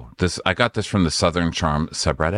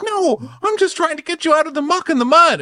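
high, loud mock plea; mocking Shep; 5 dB louder than usual. Shep pleading with Madison, high loud mock whine. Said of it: "Shep's like".

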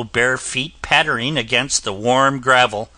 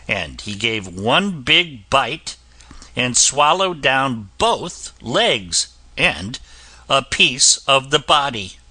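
ordinary delivery

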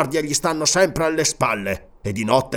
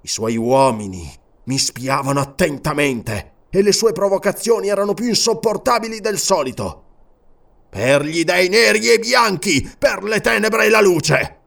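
furious tirade; 7 semitones higher than usual. A narrator's reading. King to servant, raging over the crucified man. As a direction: vent his fury at Rome. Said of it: "in preda al furore".

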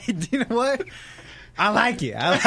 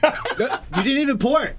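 high laughing protest; 7 semitones higher than usual. Real, not acted. Teasing his cohost about the sake, laughing. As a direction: laugh through the complaint.

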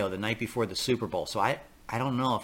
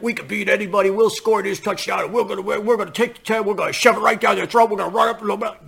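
cocky team bravado; 7 semitones higher, 5 dB louder than usual. Retold or imitated.

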